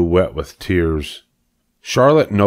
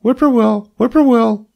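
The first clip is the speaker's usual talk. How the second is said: much higher, sung-out whippoorwill call; bird call; 4 dB louder than usual. Acted. Bird singing across the road, high whistled call. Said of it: "a bird sang".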